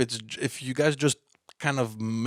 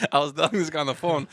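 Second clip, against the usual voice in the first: laughing; 4 semitones higher, 4 dB louder, faster than usual. Real, not acted. Laughing while telling.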